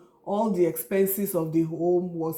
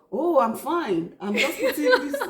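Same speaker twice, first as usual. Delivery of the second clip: mock casual cover-up; 7 semitones higher than usual. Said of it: "I'll be like".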